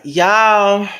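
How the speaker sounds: a sudden loud, high cry of disbelief to the audience; overwhelmed shout. Real, not acted. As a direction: call out to the fans, overcome. Overwhelmed by fans' support.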